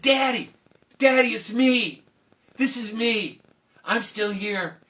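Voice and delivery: loud high cry of "Daddy"; high emotional call; joyful calling. Acted. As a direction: daughter reaching her father. Princess calls to father.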